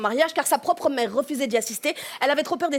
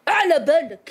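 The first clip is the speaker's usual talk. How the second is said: virulent Algerian; 4 dB louder than usual. Shown as a demonstration. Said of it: "un côté légèrement plus virulent".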